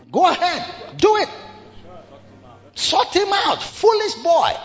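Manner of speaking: high taunting jeer; mocking Saul urging them on. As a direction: egg the crowd on with scorn.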